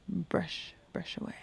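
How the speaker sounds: whispered repeat of brush away; hushed echo. Real, not acted. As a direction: murmur brush away softly to yourself.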